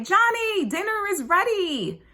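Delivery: high, sing-out call across the house; good-natured yell. Acted. Parent calls faraway kids to a cooked dinner, good-natured yell. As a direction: project your voice to far-off kids. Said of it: "So you yell and you're like".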